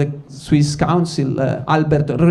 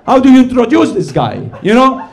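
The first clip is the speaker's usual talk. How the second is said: hammed-up indignation, cracking up; comic telling the crowd about the new councillor, laughing and exasperated; mock outrage; 5 semitones higher than usual.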